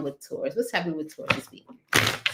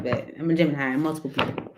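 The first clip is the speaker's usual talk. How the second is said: ordinary talk, nothing marked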